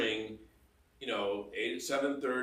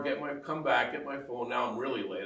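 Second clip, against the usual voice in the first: ordinary delivery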